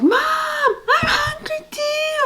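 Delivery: very high, drawn-out mock toddler wail; high, stretched-out mock screech; whining toddler. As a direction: imitate a hungry child's tantrum, slow and piercing. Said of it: "Terrible twos".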